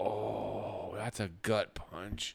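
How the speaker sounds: hushed retold reaction. Viewer reliving a brutal TV moment, hushed and slow. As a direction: say it softly and very slowly, letting it sink in.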